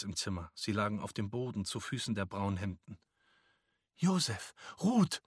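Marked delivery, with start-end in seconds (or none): 4.0-5.2 put-on high, loud cry of the children's names; mother's cry. A narrator's reading. Mama sees her two children in the room, crying out. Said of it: "schrie Mama".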